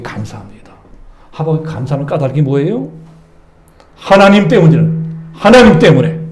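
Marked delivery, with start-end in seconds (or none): 4.0-6.2 loud emphatic preaching. Acted. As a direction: proclaim it with force.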